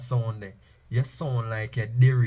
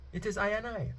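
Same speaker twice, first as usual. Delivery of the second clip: much higher and softer; taken higher; 9 semitones higher, 8 dB quieter than usual. Shown as a demonstration. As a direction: lift it way up. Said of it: "we can take it higher too".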